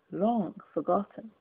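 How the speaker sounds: ordinary delivery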